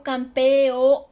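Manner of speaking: loud "campeo-" with pe and o apart; separated hiatus. Shown as a demonstration. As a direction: hit the e and o of "campeo-" as two vowels. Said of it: "Se separó".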